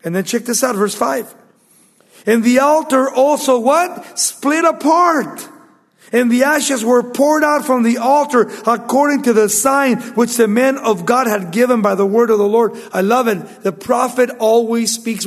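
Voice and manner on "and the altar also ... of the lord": preaching climax. Acted. Preacher calling out the altar splitting apart, high and exultant. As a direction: lift the voice for the miracle.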